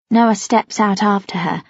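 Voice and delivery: ordinary delivery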